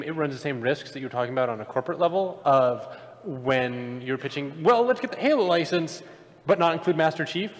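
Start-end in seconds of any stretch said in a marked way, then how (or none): none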